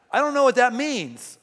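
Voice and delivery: normal talking voice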